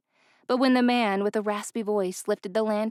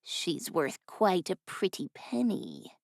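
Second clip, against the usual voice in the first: raspy voice; 5 dB quieter, slower than usual. A narrator's reading. Raspy thug calmly answering the driver, soft and slow.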